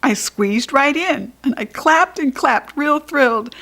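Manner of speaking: usual speaking voice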